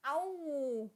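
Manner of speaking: ordinary delivery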